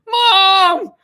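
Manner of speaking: high, loud desperate shriek; screaming for mom. Retold or imitated. A teen shrieking for his mom. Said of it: "He started screaming".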